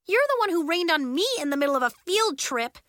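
high, indignant accusation; crying out. A narrator's reading. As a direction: fling the blame back. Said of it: "Nori cried".

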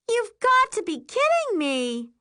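high, loud, exasperated grumble; grumbling. A narrator's reading. Carly is back in the water, loud high grumble. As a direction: grumble it loudly, pitched right up. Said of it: "Carly grumbles".

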